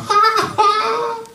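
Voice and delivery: high, scornful burst of laughter; mocking laugh. Mourners laughing at Jesus, high mocking laugh.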